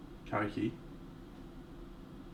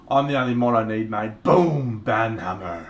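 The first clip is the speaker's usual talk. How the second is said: cocky boast; 6 dB louder than usual. Pretend. Boasting about banning chatters himself.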